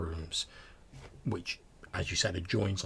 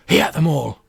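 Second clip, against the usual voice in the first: sudden high shout; loud, high-pitched scare line; ghost-story climax; 8 semitones higher, 14 dB louder than usual. Acted. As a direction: pounce on the punchline to make them jump.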